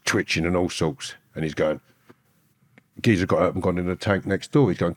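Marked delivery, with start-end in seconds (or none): none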